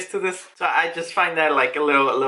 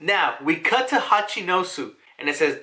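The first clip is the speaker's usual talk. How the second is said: ordinary talk, nothing marked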